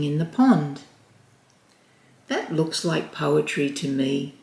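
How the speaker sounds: gentle read-aloud storytelling voice; storybook narration. A narrator's reading. Storyteller reading a picture book to children, gentle and lively. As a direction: read it like bedtime story time for small kids.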